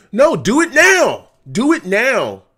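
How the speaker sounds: loud, urgent demand; frustrated shout. Real, not acted. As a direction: bark it like you're on the sideline. Yelling at coaches from stream.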